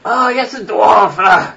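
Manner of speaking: put-on an old priest recognizing a dwarf.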